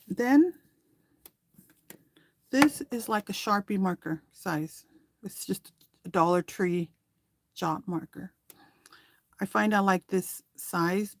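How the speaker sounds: ordinary talk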